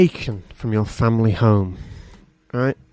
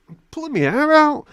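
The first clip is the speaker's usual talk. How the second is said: very high frustrated outburst; exasperated cry; 14 semitones higher, 4 dB louder than usual. Acted. Torturer finding his knife is a comb, exasperated cry. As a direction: cry out at the end of your tether.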